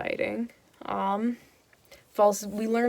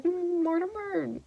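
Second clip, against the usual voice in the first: much higher, slow, put-on kingly "Mm, Mortimer"; sceptical king; 10 semitones higher, 4 dB quieter than usual. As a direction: draw it out like a doubting king. The king voicing suspicion.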